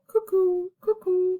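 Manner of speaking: high two-note cuckoo; cuckoo call. A narrator's reading. Reader recapping the cuckoo's noise, calling. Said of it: "The cuckoo called".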